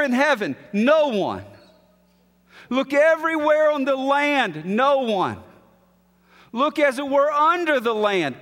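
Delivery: rising, ringing sermon voice building to "No one"; preaching at full force. Acted. Preacher telling the congregation no one is worthy, ringing and forceful. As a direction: hammer each "No one" home like a verdict.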